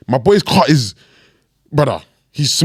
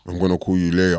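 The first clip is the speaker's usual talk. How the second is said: low, quiet, bored mumble; flat unenthusiastic; 8 semitones lower, 5 dB quieter than usual. Retold or imitated. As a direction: imitate a friend who can't be bothered. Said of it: "This is a guy that's usually so enthusiastic".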